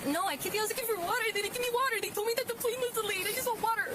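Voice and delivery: high, pleading for water. Real, not acted. Distressed passenger pleading for water.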